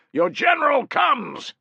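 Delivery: slow, high, loud roar to the crowd. Acted. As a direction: announce him at the top of your lungs. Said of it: "he roared".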